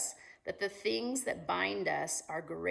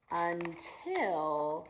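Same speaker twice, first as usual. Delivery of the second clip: plain reading voice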